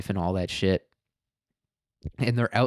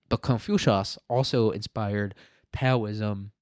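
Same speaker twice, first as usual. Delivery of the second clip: ordinary delivery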